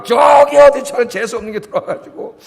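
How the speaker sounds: hammed-up in-law blaming the bride; mother-in-law scolding. Drama mother-in-law blaming her daughter-in-law, mock angry shout. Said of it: "며느리를 잡더라고".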